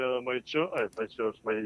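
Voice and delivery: usual speaking voice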